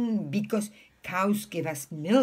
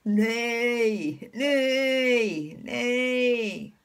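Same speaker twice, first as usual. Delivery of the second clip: long, drawn-out imitated neighs; sustained neighing that falls at the end; horse neigh; much slower than usual. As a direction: imitate a horse, stretching each call. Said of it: "what does the horse say".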